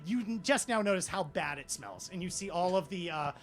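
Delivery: ordinary talk, nothing marked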